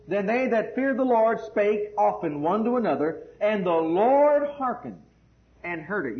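loud, slow declamation with stretched words; scripture reading. Acted. Preacher reads the next verse to the congregation, loud proclamation. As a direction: proclaim the verse slowly and forcefully.

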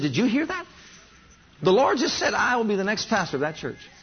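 high, incredulous voice; acted-out startled insistence; retold alarm. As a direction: relay it in high-pitched disbelief. Retold alarmed report to companion.

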